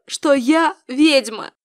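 usual speaking voice

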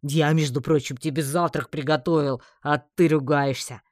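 put-upon orc dragging out the last words; whining. A narrator's reading. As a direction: complain like a wronged cook. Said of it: "привычно проныл орк".

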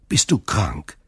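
mildly anxious question; worried. A narrator's reading. Son asks whether his father is ill, worried. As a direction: ask with understated alarm. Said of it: "rief Edmond beunruhigt".

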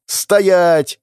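loud sharp order to halt; girl yells at the injured friend to stop; commanding shout. Acted. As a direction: stop him with one loud word.

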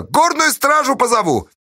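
very high, loud threat; threatening yell. A narrator's reading. Fedka threatening to call guards, high yell. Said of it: "заорал Федька".

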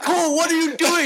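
high, loud, laughing disbelief at a friend; laughing call. Retold or imitated. Friend Cole follows him laughing.

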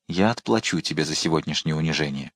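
low, soft threat; vengeful. A narrator's reading. Queen vowing revenge at the closed door, low threat.

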